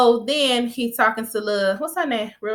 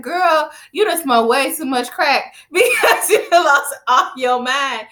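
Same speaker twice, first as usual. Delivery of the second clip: loud, high, slowed incredulous roast breaking into laughter; laughing disbelief; 5 semitones higher, 5 dB louder, slower than usual. Real, not acted. Viewer reacting to Tracy's love-story claim, laughing disbelief. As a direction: tell her off while cracking up.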